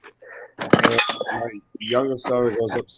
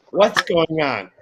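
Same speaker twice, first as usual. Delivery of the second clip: mom's raised-voice question, imitated; higher, louder, drawn-out mock demand; imitated alarmed demand; 6 semitones higher, 5 dB louder, much slower than usual. Mom hears a big boom, imitated alarmed demand.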